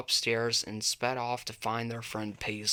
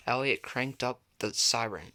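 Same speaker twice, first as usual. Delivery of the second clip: plain reading voice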